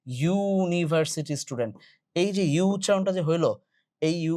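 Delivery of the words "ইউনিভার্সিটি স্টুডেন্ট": ইউনিভার্সিটি with a stretched "yuu" at the start; pronunciation demo. Shown as a demonstration. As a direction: hold the opening "you" of ইউনিভার্সিটি, then finish normally. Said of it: "এইখানে উচ্চারণটা হচ্ছে". (ইউনিভার্সিটি-র শুরুর "ইউ" টেনে উচ্চারণ)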